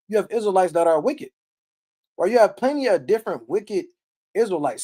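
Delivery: ordinary talk, nothing marked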